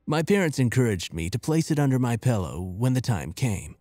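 plain reading voice

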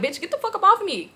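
high-pitched indignant order, reenacted; retold angry protest. Retold struggle.

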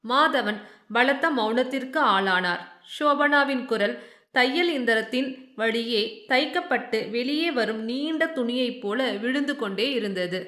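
plain reading voice

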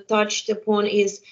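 ordinary talk, nothing marked

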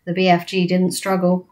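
ordinary delivery, nothing marked

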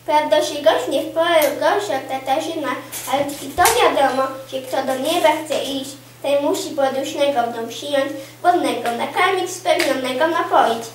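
ordinary delivery

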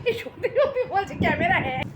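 high, loud, through laughter; laughing protest. Real, not acted. Mother laughing to her daughter that the camera froze. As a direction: laugh it out in disbelief.

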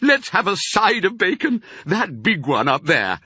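high, eager, loud request; excited badger. A narrator's reading. Badger begs for bacon, excited. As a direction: clamour for the big one. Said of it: "dancing with excitement".

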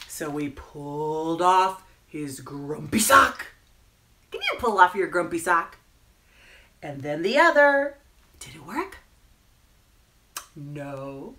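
stretched words building to loud comic punchlines; children's story narration. A narrator's reading. Storyteller undressing the grumpy penguin for children, animated narration. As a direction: draw it out, then hit the silly word. Reads picture book to children.

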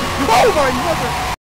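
loud startled cry; startled yell. Real, not acted. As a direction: jump and yell in fright. Startled in horror game.

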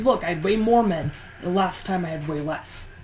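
ordinary talk, nothing marked

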